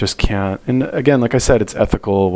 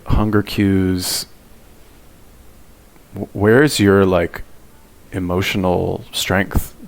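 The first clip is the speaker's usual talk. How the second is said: slow, scornful "hunger cues" in her usual pitch; mocking quote; much slower than usual. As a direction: stretch "hunger cues" out with disdain. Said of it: "who talks like that?".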